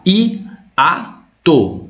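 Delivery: Hiato drawn out, i and a said apart; split into syllables. Shown as a demonstration. Teacher to students, splitting Hiato syllable by syllable. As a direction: sound out Hiato as separate syllables. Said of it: "É assim que você separa".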